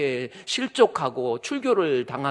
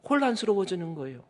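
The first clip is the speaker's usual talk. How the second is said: ordinary talk, nothing marked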